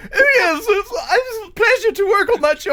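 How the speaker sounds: mock a polite British interviewee; Hugh Laurie imitation. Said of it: "I saw him being interviewed".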